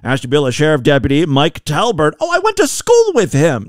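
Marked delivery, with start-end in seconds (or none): 2.2-3.6 high-pitched burst of pretend recognition; mock excited recognition. Host jokingly claims the deputy as a schoolmate, mock excited. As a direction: jump up high as if you know him.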